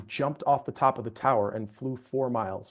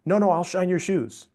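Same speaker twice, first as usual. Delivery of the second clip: pushy insistence, louder and higher; insistent vendor; 6 semitones higher, 5 dB louder than usual. Retold or imitated. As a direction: press him, won't take no. Vendor insisting, cornering him.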